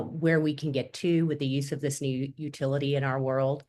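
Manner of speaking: normal talking voice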